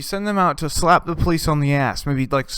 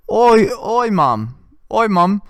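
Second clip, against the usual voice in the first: high, loud put-on British accent; British accent impression; 8 semitones higher, 5 dB louder, slower than usual. Comic mimicking a British guy hitting on a cop, in a high British accent. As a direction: ham up a cheeky British bloke accent. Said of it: "I'm trying to get the accent down".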